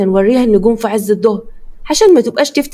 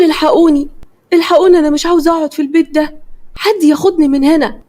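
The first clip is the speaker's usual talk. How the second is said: terrified screaming; 6 semitones higher than usual. A narrator's reading. Frightened bride screaming for help in the dark house. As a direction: scream for rescue in panic. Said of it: "سما فضلت تصرخ".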